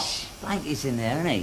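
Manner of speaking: whispering. Acted. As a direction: hush him so nobody next door hears. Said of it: "What are you whispering for?".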